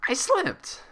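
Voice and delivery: defensive. A narrator's reading. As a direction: insist on it, on guard. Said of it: "she said defensively".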